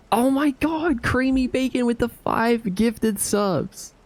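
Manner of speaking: high, slow, thrilled cry; excited shout. Real, not acted. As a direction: gasp it out, overjoyed. Thrilled at surprise gift subs.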